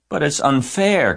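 high, loud outcry; crying out. A narrator's reading. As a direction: cry it out in protest. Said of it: "cried Fern".

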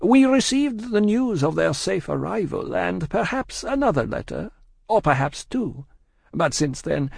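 high-pitched Madame, measured report; Madame, cool shopkeeper. A narrator's reading. Madame tells the spy of lapsed letters, high and measured.

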